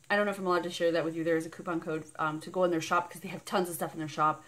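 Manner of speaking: ordinary talk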